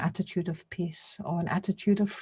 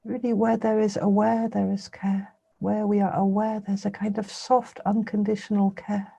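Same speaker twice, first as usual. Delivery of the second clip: meditation guidance in spaced phrases, long pauses; guided meditation. Real, not acted. Teacher bringing listeners' attention gently to the breathing, guided meditation. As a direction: leave silence between phrases as you guide.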